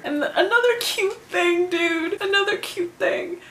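high-pitched fangirl delight; excited gushing. Real, not acted. Fan announcing another cute thing, high excited gushing.

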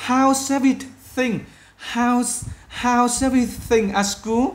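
loud, high, slow model greeting; upbeat greeting demo. Shown as a demonstration. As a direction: greet warmly and stretch it out. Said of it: "từ đây thế nào". (chào hỏi mẫu to, chậm, giọng cao)

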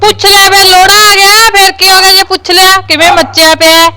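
high, loud nagging shout; loud scolding. Acted. Neighbour loudly scolding the son for his fib. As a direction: shout her down, needling him.

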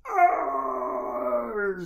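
loud, high growling arr; ghoul roar. Acted. Gadar the Ghoul roaring in pain, loud arr. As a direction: let out an angry monster cry.

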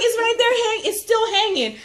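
high loud panicked cry, breaking into laughter; panicked squeal. Retold or imitated. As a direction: yelp it out, frightened and laughing. Panicking, spider still in car.

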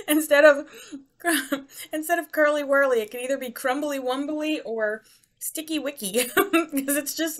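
high, loud laughing words. Real, not acted. Laughing while chewing.

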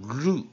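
"ru" with a long oo vowel. Shown as a demonstration. Teacher showing the long vowel, "ru" drawn out. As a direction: hold the vowel of "ru". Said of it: "生出长母音ru".

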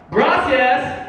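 high-pitched mock shout in Spanish; loud foreign-language shout. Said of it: "You just gotta be loud".

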